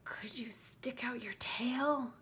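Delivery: slow nervous whisper. A narrator's reading. Nervous child asks the monster under the bed, whispering. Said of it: "I whispered".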